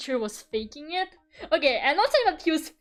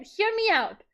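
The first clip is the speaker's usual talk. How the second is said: ordinary talk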